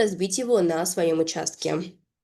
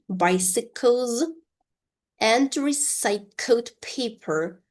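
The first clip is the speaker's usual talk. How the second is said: slow, higher-pitched model reading; slow repeat-after-me; 4 semitones higher than usual. Shown as a demonstration. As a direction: separate the words slowly for learners. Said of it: "pronounce this sentence one more time slowly".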